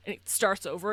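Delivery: usual speaking voice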